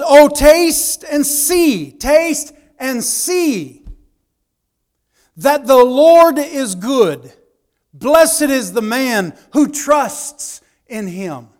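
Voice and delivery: slow, solemn, swelling recitation; reciting a psalm. Acted. Preacher reading Psalm 34 to the church, slow and solemn. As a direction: savor each word of the psalm. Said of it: "The psalmist says".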